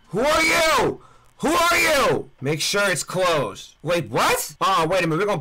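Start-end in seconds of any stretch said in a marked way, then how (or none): 0.1-2.3 alarmed shout. Real, not acted. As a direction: bark it at the stranger out of fright. Shouting at stranger in garage.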